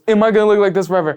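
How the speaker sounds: high, loud, rushed mock panic; panicked inner thought. Storyteller replays the thought after a bike crash, mock panicked. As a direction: blurt it out in exaggerated dismay. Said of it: "that's what's going through my head".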